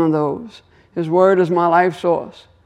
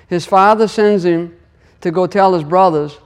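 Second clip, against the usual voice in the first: ordinary delivery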